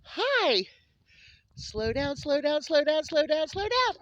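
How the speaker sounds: high, loud excited call to slow down. Real, not acted. Owner laughingly calls to a rushing pet to slow down. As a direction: call out to a charging animal. Animal charging at her.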